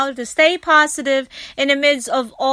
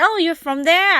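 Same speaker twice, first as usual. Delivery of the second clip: surprised question; 6 semitones higher than usual. Retold or imitated. Chatting with stranger.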